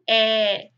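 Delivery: É said open like in café; open vowel É. Shown as a demonstration. Teacher showing the open É to pupils. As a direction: open the E sound wide. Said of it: "O E vai ficar".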